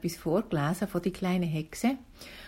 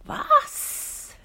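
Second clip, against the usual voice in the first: shrill squawked raven shriek, much higher; horrified cry; 7 dB louder than usual. Acted. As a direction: yelp it out in horror like a raven. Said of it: "rief Abraxas entsetzt".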